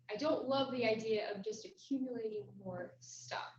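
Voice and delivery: ordinary talk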